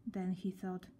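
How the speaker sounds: plain reading voice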